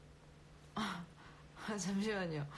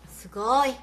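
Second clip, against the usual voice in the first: loud burst of wow; excited exclamation; 3 semitones higher, 11 dB louder, slower than usual. Real, not acted. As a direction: shout it with delight. (신나서 외치듯)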